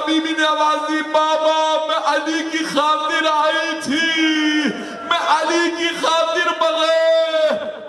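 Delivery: very high, slow, wailing cry; anguished shout. Acted. Preacher voicing Salman and Fatima at the grave, wailing shout. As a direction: stretch each word into a mourning cry. Climax of majlis, shouted wail.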